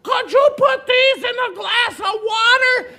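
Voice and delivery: put-on very high, loud, warbly old lady voice; toothless old woman. Old woman without her dentures asks the girl, high and loud. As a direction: squeak it out like a gummy old lady. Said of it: "and says".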